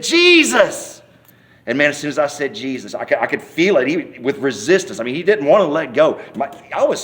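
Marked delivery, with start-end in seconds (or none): 0.0-1.0 high, drawn-out loud cry; hollered at full volume. Retold or imitated. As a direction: holler it as loud as you can. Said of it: "I hollered out just as loud as I could".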